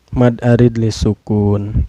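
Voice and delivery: ordinary talk, nothing marked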